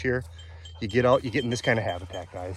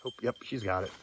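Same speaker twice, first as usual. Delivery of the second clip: normal talking voice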